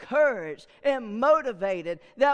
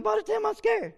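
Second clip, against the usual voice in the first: scared; 10 semitones higher than usual. Retold or imitated. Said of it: "she was scared to death".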